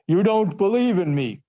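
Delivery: a lightly played ghost calling out the doubter. Ghost of Marley confronting the skeptical Scrooge, loud ghostly. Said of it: "I'll do my ghost voice".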